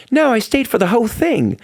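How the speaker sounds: high, light imitation of a puzzled star; mock-defensive, high; confused protest. [name] mishears, retold dialogue.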